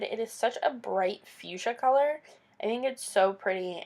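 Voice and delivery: ordinary talk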